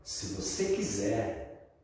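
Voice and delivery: very slow, measured preacher's line; drawn-out solemn offer; pastor's deliberate offer. Retold or imitated. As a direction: stretch each word out, pausing after. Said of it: "Eu preciso falar uma coisa".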